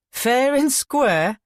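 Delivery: slow, high mocking echo with a snigger; sniggering mockery. A narrator's reading. Todd mocking Ian's talk of fairness, sniggering. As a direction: scoff at his words, drawing them out. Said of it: "replied with a snigger".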